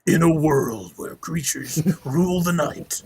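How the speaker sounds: slow, drawn-out movie trailer voiceover; hammed-up trailer announcer intoning an epic opener. Shown as a demonstration. Co-host busts out his voiceover for the group, hammed-up trailer voice. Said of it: "my voiceover voice".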